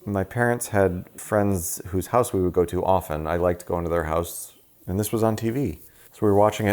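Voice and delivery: ordinary delivery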